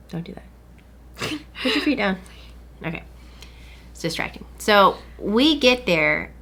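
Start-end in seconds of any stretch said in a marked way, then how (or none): 0.1-3.0 unvoiced whisper to the side; hushed aside. Real, not acted. Whispering to someone off to the side to stop. 3.9-4.4 unvoiced whisper to the side; hushed aside. Real, not acted. Whispering to someone off to the side to stop.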